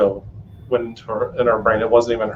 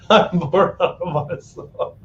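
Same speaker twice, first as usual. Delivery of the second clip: slow, higher whiny dog voice; mock bored dog; 5 semitones higher, slower than usual. Guest echoes the bored dog, slow and higher. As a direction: draw it out like a sulky pet.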